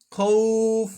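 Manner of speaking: "খৌফ" with a long au, ending on f; sustained recitation-style "খৌফ"; one-alif stretch. Shown as a demonstration. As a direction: hold the au of "খৌফ" before the f. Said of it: "একালিফ টেনে পড়বো".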